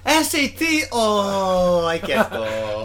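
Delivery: hammed-up Japanese accent; mock Japanese accent. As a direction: play a stressed Japanese student in a jokey accent. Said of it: "did I just do the worst Japanese accent".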